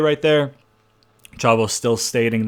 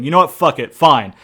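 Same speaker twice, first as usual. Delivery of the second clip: snapping in anger; 7 dB louder than usual. Retold or imitated. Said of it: "he kinda snaps".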